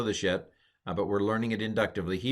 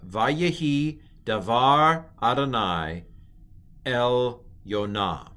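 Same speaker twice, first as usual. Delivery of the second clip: slow, deliberate Hebrew recitation; model reading; 2 semitones higher, 4 dB louder, slower than usual. Shown as a demonstration. Teacher reads the whole verse to students, slow model reading. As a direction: read each word out slowly for learners.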